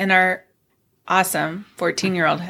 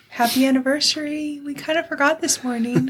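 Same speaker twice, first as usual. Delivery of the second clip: higher, sheepish singsong; mock-cheerful higher voice for a quoted message; reading out a text; 7 semitones higher than usual. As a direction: lift the pitch as if sheepishly confessing by text. Reads out her own text message.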